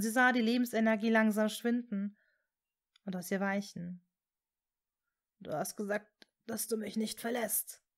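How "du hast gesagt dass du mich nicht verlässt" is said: soft, hushed despair; desperate breath. A narrator's reading. Severus pleads with dying Hermine, desperately hushed. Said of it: "hauchte er verzweifelt".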